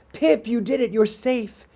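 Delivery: high-pitched triumphant call; relieved cheer. Acted. Boys tell Pip he is safe, excited. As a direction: tell the runner the race is won.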